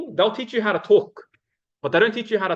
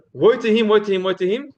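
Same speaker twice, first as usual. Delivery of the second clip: louder, higher "Woe to him" in a quick chain of three; emphatic repetition; 4 semitones higher, 5 dB louder than usual. Acted. Speaker repeating the warning to hook listeners in, emphatic repetition. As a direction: punch each "Woe" to hook the crowd. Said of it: "He would repeat things".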